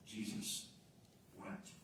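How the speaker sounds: soft, near-whispered and slow; hushed reverence. Real, not acted. Preacher reciting the shortest verse to the church, hushed. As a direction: let it land in hush.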